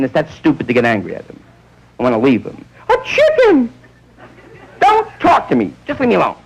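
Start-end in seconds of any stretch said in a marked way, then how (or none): 2.9-3.7 high outraged shriek; shrieking wife. Retold or imitated. Wife finds husband with chicken. 4.8-6.3 huffy wife. Retold or imitated. Wife furious at husband.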